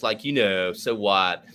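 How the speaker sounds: put-on American Valley Girl hybrid; lazy mock American Valley Girl; Valley Girl accent. Shown as a demonstration. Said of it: "my Valley Girl, a, a cipher hybrid".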